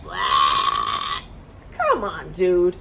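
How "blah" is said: a deep, low retching blah, much lower; low gag noise; mock vomit blah. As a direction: fake throwing up. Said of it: "vomited all over me".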